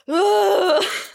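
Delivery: loud, high exhaled whoop; energy shake-off sigh. Real, not acted. Host shaking off weird energy with co-host, loud high sigh. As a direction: shake the energy out with a big vocal release. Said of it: "let's just do an energy shake off".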